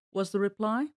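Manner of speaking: ordinary delivery, nothing marked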